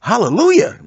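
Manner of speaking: loud high cry of praise; yelled hallelujah. Retold or imitated. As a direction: call it out in sleep. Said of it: "she yells out".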